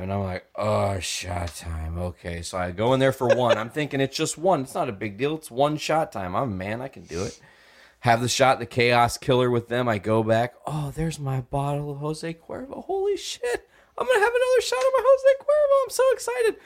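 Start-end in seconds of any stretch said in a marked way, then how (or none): 0.5-2.4 slow drawn out. Retold or imitated. Retold drunk reply. 10.7-16.6 put-on much higher, fast, giddy drunk; excited high voice. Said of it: "I can't do the high voice".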